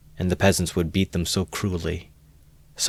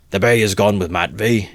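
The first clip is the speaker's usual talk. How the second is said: loud, raised call; shouted from the cart; 3 semitones higher, 5 dB louder than usual. Acted. Mikulka explaining to his mates, loud shout. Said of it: "he shouted from the cart".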